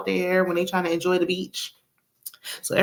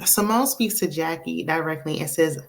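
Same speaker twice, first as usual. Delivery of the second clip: usual speaking voice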